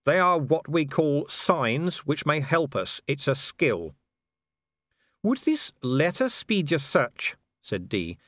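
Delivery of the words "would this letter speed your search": pointedly helpful. Acted. Dee pointedly offers letter.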